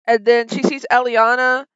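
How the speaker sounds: normal talking voice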